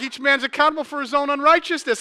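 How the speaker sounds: hammed-up wife preaching back; wife's pious jab. Wife lecturing him about his dirty phone, mock-solemn.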